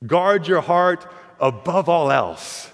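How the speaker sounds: slow, grave reading of Guard your heart; solemn scripture reading. Shown as a demonstration. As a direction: lay each word down slowly like scripture. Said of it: "Proverbs four twenty-three says this".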